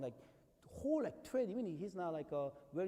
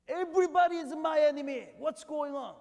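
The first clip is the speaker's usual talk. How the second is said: shouting that everyone is against him; paranoid shout; 10 semitones higher, 12 dB louder than usual. Acted. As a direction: cry it out loud and high, a cornered king. Pastor voicing paranoid King Saul.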